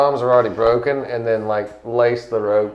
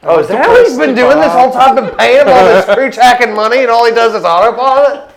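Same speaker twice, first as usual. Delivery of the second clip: high, loud, fast disbelief; indignant outburst; 9 semitones higher, 7 dB louder than usual. Real, not acted. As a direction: blurt it out in outrage. Outraged at the pilot.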